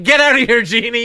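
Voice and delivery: shouted, high and loud brush-off; loud dismissal. Retold or imitated. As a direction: bark it out loudly, waving him off. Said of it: "For me, it was just like".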